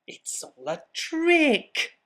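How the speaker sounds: high, slow, indignant little monster; exasperated. Acted. Gruffalo's child giving up on the mouse, high and indignant. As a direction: stamp it out, fed up with the trick. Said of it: "said the Gruffalo's child".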